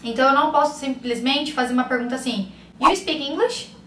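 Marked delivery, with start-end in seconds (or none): 2.8-3.7 "You speak English?" higher, rising into a question; question by intonation alone. Shown as a demonstration. Teacher showing students a common mistake, "You speak English?" as a rising question. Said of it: "Mudar só a entonação".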